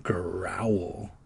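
soft unvoiced growl; whispered growl. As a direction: breathe a growl. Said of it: "reader growls the word".

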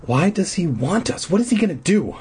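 ordinary delivery

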